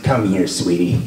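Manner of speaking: low, mock sweet-talk; put-on low seductive voice; amorous woman. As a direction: imitate a come-on, dropping low and soft. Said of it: "she wanted a makeout session".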